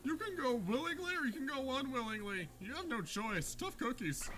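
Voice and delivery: high unearthly spirit voice; haunt them, high and eerie. Acted. Spirit voice continues, teased after.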